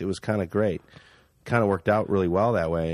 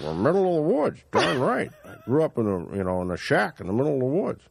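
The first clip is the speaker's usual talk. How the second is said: imitated backwoods Canadian accent, pitched up at first; 3 semitones higher than usual. Actor answers as the driver, backwoods Canadian accent. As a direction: play the woodsman with a rural Canadian accent. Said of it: "kind of doing his accent".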